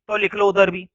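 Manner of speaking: normal talking voice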